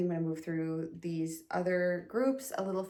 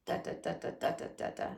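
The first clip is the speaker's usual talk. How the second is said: whispered rhythm; 7 dB quieter, faster than usual. Shown as a demonstration. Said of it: "rhythm demo continued".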